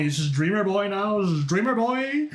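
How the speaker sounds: high rising disbelief; incredulous question. Real, not acted. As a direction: ask it in mock outrage. Mock outrage at a nickname.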